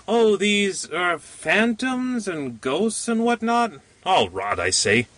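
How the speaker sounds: lightly voiced little man scoffing at ghosts; little man, dismissive. A narrator's reading.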